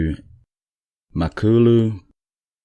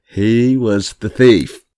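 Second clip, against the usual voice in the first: laughing; 4 semitones higher, 5 dB louder, much slower than usual. Real, not acted. Punchline of biscuit story.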